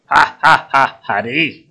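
loud staged "ha ha ha" laugh; mocking laugh. Crab gloats over pinched Hari, mocking laugh. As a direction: laugh loudly at your victim.

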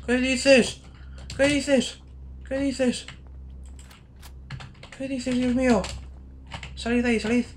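very high squealed exclamations, repeated; high-pitched freak-out. Acted. Gamer to whoever is in there, get out, high-pitched squealing. Startled frantic shrieking at game.

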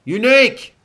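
very high, loud warning shout; urgent, piercing battlefield call; alarm cry. A narrator's reading. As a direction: cry out to warn everyone. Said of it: "came the cry".

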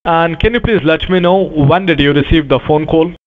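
ordinary talk

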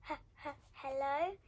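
hesitant stuttered greeting, rising at the end; timid, questioning call into a dark cave; nervous stammer. Acted. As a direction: stammer it out, trying to be brave. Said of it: "Pip tried to be brave".

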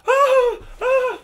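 high, loud shocked cry; startled shriek. Retold or imitated. Startled mom seeing a bleeding boy, shrieking. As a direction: recoil in shock. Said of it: "She was like".

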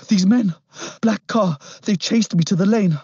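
put-on high, loud urgent boy's voice; scared boy. A narrator's reading. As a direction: blurt it out in fear.

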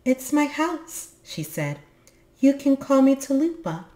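put-on sweet, light otherworldly girl; fairy girl voice. Blue-haired fairy girl speaks.

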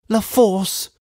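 high-pitched, anguished reply; distressed answer. A narrator's reading. Lucy answers which prison.

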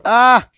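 short high yell; startled yelp; scream of fright. Real, not acted. Dinosaur attacking.

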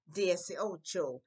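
Dieciocho drawn out slowly; Dieciocho, slow and clear for repeating; slow model. Shown as a demonstration. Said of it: "teacher models word".

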